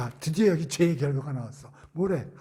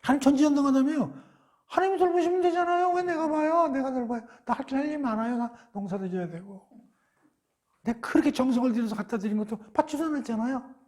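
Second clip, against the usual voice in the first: defiant Cain; 7 semitones higher than usual. Acted. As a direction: talk back to God, sullen and defiant. Cain arguing with God. (하나님께 대드는 가인, 높고 크게 반항하는 말투)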